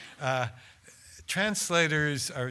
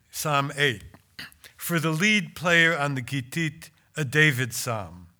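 louder, solemn recitation of the psalm; psalm reading; 5 dB louder than usual. Acted. Translator reading his psalm aloud to the audience, solemn and loud. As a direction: proclaim it like a psalm.

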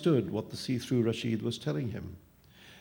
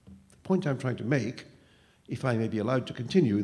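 ordinary delivery

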